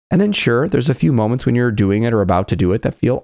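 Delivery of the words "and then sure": ordinary talk, nothing marked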